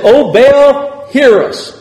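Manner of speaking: mock cry to Baal. Baal's prophets crying out.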